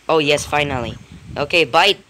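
normal talking voice

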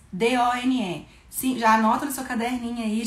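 ordinary talk

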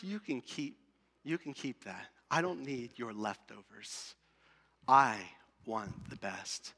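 low, soft, measured divine voice; restrained, low-pitched firmness; quiet refusal. Acted. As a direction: voice God declining, hushed and deep. God voiced refusing the leftovers.

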